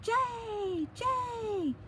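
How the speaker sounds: high squawking bird call, "Jay! Jay!"; loud blue jay cry. A narrator's reading. Blue jay squawks at the sneaking cat, high bird cry. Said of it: "cried out with a loud".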